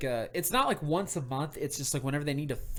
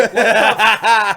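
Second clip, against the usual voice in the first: loud, high, slow laughing what the f; shocked yell; 6 semitones higher, 17 dB louder, much slower than usual. Retold or imitated. Retelling his reaction to Zach, loud laughing disbelief. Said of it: "And I'm like".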